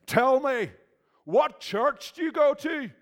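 high, loud attempt at an Irish accent; a half-hearted Belfast tough-guy voice; an intimidating man on the Shankill Road, in a put-on Irish accent; mock Northern Irish accent. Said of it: "That wasn't even an Irish accent".

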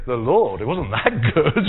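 loud dry scoff; mock skeptical. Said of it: "you feel like saying".

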